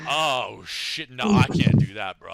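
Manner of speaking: loud startled protest; shocked outburst. Real, not acted. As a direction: blurt it out, shocked. Reacts to gross donation.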